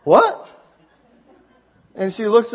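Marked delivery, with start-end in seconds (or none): 0.0-0.5 sharp high, loud "What?"; mock startled. Phone caller turning to the man waving at her, mock startled. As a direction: imitate a caught-off-guard, high yelp of a question. Acted reaction of woman on phone.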